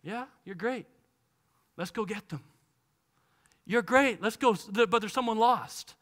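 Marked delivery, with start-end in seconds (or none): none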